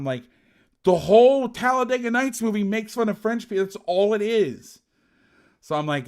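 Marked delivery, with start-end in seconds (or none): 0.8-4.8 higher, louder exasperated explaining; exasperated retelling. Retold or imitated. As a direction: urge him to see the problem. Explaining urgently to teammate.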